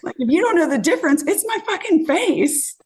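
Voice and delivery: high, pointed retort, slowing on the last words; indignant retort. Retold or imitated. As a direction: drive home it's my face, incredulous. Retelling retort to slapper.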